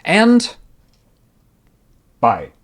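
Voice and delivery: high, loud announcement, then a dropped goodbye; comic big exit with a long pause; mock abrupt farewell. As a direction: sign off grandly and vanish. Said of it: "the prince was just like".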